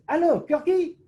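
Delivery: high, loud put-on phone greeting; Didier Mene. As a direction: imitate an excitable referee answering the phone. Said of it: "He answers, goes".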